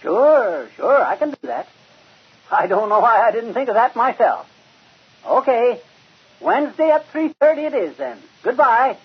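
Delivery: high, loud and keen; eager agreement. Acted. Fibber agreeing eagerly with the scout office on the phone. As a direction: jump at the offer.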